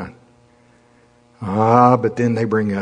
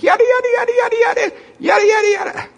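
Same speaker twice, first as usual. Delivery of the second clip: high, loud shrill calling; calling the sheep in a shrill high voice; loud shrill stranger; 5 dB louder, faster than usual. Retold or imitated. Girl calling sheep in a loud shrill high voice. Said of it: "in a loud, shrill voice".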